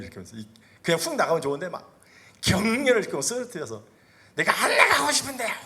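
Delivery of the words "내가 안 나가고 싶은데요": high, loud, laughing put-on demon voice; mock the stubborn demon in a squeaky laughing voice. Demon refuses to leave the boy, laughing squeaky defiance.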